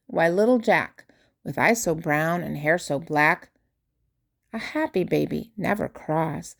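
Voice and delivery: plain reading voice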